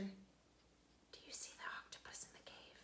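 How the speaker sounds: breathless whisper; whispered question. Real, not acted. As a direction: whisper so the octopus won't hear. Hushed question over spooky picture.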